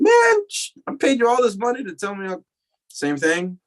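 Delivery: replaying his outrage at paying for that advice; indignant outburst at the guru; mock exasperation.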